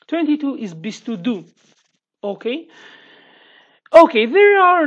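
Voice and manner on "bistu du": "bistu du" said as a Kurdish demo; Kurdish number. Shown as a demonstration. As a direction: pronounce "bistu du" clearly for learners. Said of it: "In Kurdish, we say like this".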